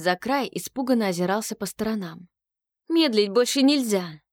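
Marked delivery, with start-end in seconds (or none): none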